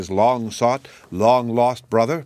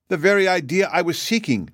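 higher-pitched, mildly joyful exclamation; pleased burst of relief, lightly acted; 8 semitones higher than usual. A narrator's reading. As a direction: exclaim with a touch of delight. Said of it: "exclaimed Shaggy joyfully".

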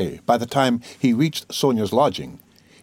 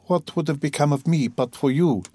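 Sonia's quick grateful words; 4 semitones higher than usual. A narrator's reading. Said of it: "she said quickly".

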